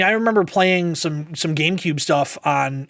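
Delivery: ordinary talk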